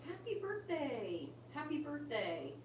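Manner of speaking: put-on high, slow little-kid sing-song; lightly imitating a child chanting happy birthday. The parrot's child voice calling happy birthday, soft and high. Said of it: "a kid voice saying".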